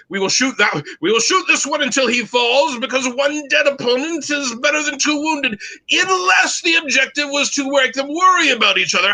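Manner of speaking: high, commanding mock British officer accent; terrible British accent. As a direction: lecture the troops in plummy put-on British. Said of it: "I will do my terrible British accent".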